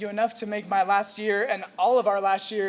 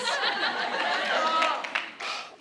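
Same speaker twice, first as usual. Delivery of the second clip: long, unvoiced breath out, overcome; emotional sigh; 6 semitones higher, 3 dB quieter than usual. Real, not acted. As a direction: breathe out to steady herself. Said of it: "just give me a second".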